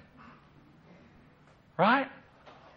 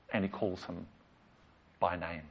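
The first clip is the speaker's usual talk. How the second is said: hushed repeat; 5 semitones lower, 11 dB quieter than usual. Shown as a demonstration. Repeated line, hushed take.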